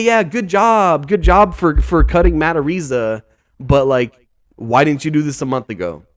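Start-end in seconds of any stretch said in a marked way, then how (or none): 0.0-3.2 sarcastic praise. Real, not acted. Commentator to the team over a late cut, sarcastic. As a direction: stretch out the hollow approval.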